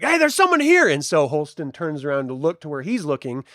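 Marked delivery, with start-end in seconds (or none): 0.0-0.9 high, loud call-out; alarmed shout. Acted. Gang member warning the camp of intruders, high and loud. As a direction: shout to warn the others. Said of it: "He's like".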